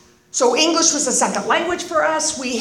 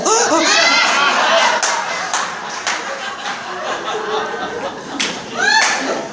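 exaggerated adoring gasp; awestruck gasp; 9 semitones higher than usual. Mocking Eddie's fans at the meeting, awestruck gasp. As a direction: gasp as if deeply moved.

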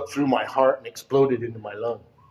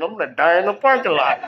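higher, louder panicked thought; mock panicked outburst; retold panic; 7 semitones higher, 6 dB louder than usual. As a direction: act out alarm at dying out there. Retold panic in parking lot.

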